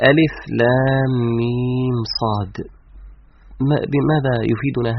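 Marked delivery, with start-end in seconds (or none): 0.1-2.6 slow, elongated recited letter names; Quranic recitation. Shown as a demonstration. Teacher reciting the verse's opening letters, slow recitation. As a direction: draw each letter out evenly, softer. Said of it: "الله سبحانه وتعالى يقول".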